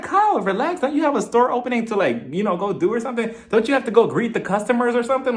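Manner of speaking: high, exaggerated plea to Kyle; high mock voice. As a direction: pitch it up, teasing and pushy. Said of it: "I'm like".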